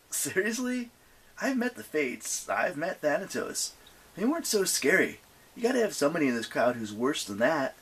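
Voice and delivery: put-on high, unimpressed teen scoff. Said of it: "Percy snorted".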